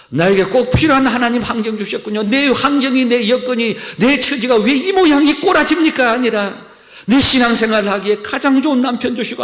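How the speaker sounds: loud, high impassioned prayer; a pastor voicing his own prayer to God, loud and high; fervent preaching prayer. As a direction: pour out each thanks at full force. Climactic sermon passage.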